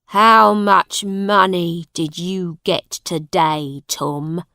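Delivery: loud father, easing off at the end; demanding father. A narrator's reading. Father demanding his son's begging money, stern. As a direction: open loudly, then drop on the name.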